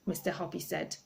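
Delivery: ordinary delivery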